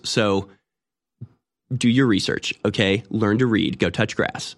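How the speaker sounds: smug, condescending know-it-all. Acted. Liberal correcting a doubter about the French fries, smug. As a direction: talk down with mock-sweet certainty. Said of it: "they just kinda get smug".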